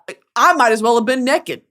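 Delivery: ordinary delivery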